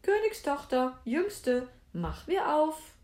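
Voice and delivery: high, loud sing-song summons; calling out. A narrator's reading. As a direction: call through a closed door. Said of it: "und rief".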